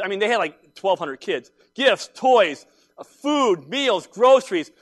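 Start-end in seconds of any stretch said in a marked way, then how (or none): 1.8-4.7 swelling emphasis. Acted. Listing the gifts, building up.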